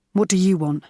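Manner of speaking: cold, hostile voice. A narrator's reading. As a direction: snap it at her, not like yourself. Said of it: "in a voice so unlike his own".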